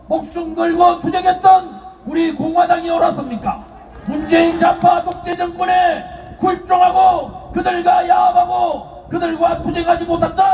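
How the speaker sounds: shouted rally speech, rising; roaring rallying speech. Acted. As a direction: hammer each question to the crowd. Rally speech, rhetorical questions.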